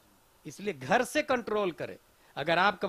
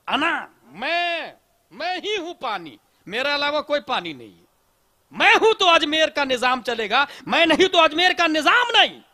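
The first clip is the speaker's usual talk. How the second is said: the lake swelling with arrogance, louder at the end; proud lake boasting; 6 semitones higher, 8 dB louder than usual. Retold or imitated. Preacher voicing the proud lake Ana Sagar, booming boast. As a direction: boast as if you alone are the water. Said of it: "ये अना सागर का गुरूर था".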